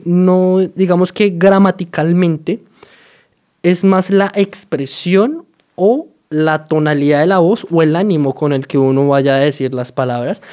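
ordinary delivery

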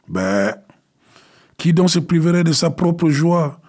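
sauterelle aiguë, plus haute et plus forte; voix stridente. Acted. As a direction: une petite voix perçante et moqueuse. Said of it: "d'une voix stridente". (la sauterelle refuse la mère, voix stridente)

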